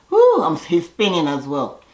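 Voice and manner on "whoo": high whooping cry of dizziness; dizzy whoo. Retold or imitated. Contestant spinning dizzy, high giddy whoo. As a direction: spin and let out a giddy whoo. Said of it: "He's like".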